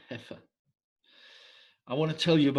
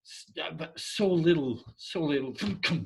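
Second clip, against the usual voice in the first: ordinary talk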